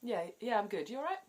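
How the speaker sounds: quick high cheery "yeah, yeah"; chirpy automatic answer; breezy reply. Shown as a demonstration. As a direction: bounce the question straight back. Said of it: "the response to that is".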